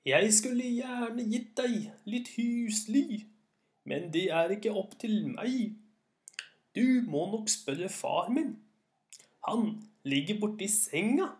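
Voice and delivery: put-on high, slow, aged old man; hundred-year-old voice. A narrator's reading. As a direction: creak it out slowly like an ancient man. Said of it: "svarte med en stemme som sikkert var hundre år gammel".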